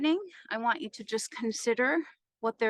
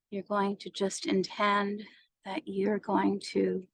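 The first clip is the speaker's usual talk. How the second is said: even meditation voice with long pauses; guided meditation. Acted. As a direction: guide them through each breath, unhurried.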